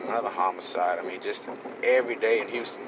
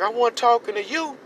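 mock scared; 13 semitones higher, 4 dB louder than usual. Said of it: "the terror in his eyes".

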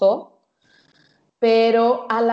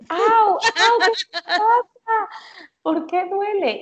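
high mock yelps of pain, au au; imitating husband yelling; 11 semitones higher, 4 dB louder, slower than usual. Wife imitating husband yelping as she brushes him. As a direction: ham up a husband yelping under a stiff brush. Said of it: "me empezó a gritar".

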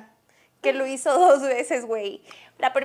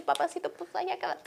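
soft, sugary sarcastic send-off; mock sweet dismissal; 5 semitones higher, 9 dB quieter than usual. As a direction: brush him off sweetly. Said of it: "le hubiera dicho". (despedida dulce y sarcástica, en voz baja)

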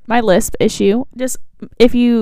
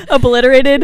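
laughing; 3 semitones higher than usual. Real, not acted. Laughing over a stumbled word.

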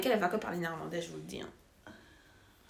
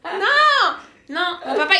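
much higher, louder no; loud protest; 8 semitones higher, 10 dB louder, much slower than usual. Real, not acted. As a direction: shout down the teasing. Loudly correcting the teasing.

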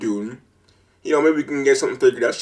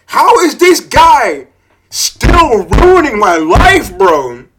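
louder, slow, disbelieving rant; exasperated outburst; 6 semitones higher, 6 dB louder, slower than usual. Real, not acted. Ranting to viewers about the seller, furious and slow. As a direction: vent the anger, dragging each word. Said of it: "I have not been this mad".

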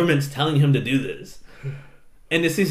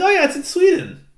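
high-pitched "just remembered" voice; mock sudden realization; 12 semitones higher, faster than usual. Acts out own sudden realization.

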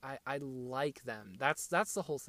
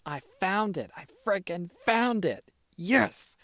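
excited shout; 7 semitones higher, 9 dB louder, slower than usual. Real, not acted. Finally finds the tweet.

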